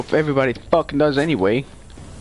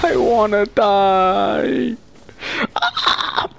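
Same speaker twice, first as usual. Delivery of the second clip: slow, high drawn-out wail; mock wail; 7 semitones higher, much slower than usual. Real, not acted. Gamer losing a fight, slow high mock wail. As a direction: stretch it out like melodrama.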